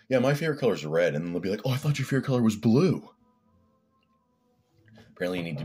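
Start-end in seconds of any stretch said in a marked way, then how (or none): 0.1-1.2 quick, high, loud quote of himself; acted casual remark. Telling somebody else a favourite color, exaggerated breezy quote. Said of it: "and I'm like". 1.7-3.1 high, loud mock accusation; mocking gotcha. Gotcha people pouncing on a changed answer, mocking high voice. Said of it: "these gotcha people".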